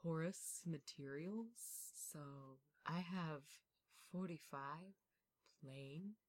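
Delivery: slow, soft creepy butler voice; spooky butler. Acted. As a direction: hush it like a eerie butler showing his house. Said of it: "sounds like a spooky butler".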